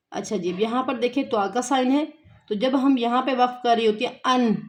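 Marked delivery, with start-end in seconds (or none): none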